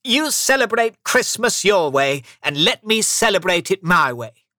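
high, loud, insistent; firm reply. A narrator's reading. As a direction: lay down the rule to your nephew. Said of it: "the uncle replied firmly".

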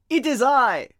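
Geppetto replying. A narrator's reading. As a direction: announce yourself loudly through the door. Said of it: "a voice replied".

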